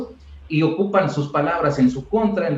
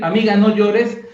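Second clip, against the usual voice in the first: mock worried plea; 5 semitones higher, 6 dB louder than usual. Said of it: "En lugar de decir".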